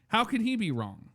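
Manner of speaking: normal talking voice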